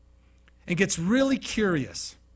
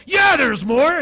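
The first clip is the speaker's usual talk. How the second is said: shouted, high; a sudden yell of pent-up anger; loud venting; 8 semitones higher, 12 dB louder, much slower than usual. Retold or imitated. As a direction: blast it out like someone still furious. Angry person venting more.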